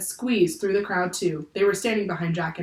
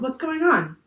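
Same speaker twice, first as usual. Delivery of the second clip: higher-pitched, mild yell; yelled question; 7 semitones higher than usual. A narrator's reading. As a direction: call out the question in alarm. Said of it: "Annie yelled".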